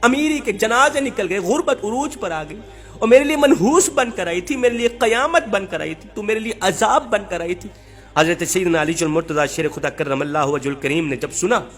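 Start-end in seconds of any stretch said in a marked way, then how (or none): none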